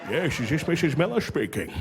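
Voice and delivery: slushy Scottish film-star voice on the phone; mock-formal Scottish caller, slurred S sounds; Sean Connery. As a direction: put on a hammed-up Scottish actor impression. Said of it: "this is Sean Connery".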